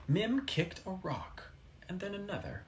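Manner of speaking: ordinary delivery, nothing marked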